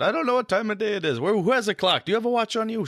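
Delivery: very high, put-on character voice; imitated professor character. Professor asks the table for the time, high character voice. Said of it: "as that character, you know, asking questions out loud".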